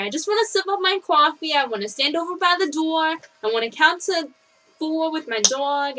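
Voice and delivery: hammed-up East Coaster drawing out coffee and dog; East Coast accent. Shown as a demonstration. Showing off an East Coaster griping to a buddy, East Coast accent. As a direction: put on a thick mock East Coast accent. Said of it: "the East Coaster ones where you're like".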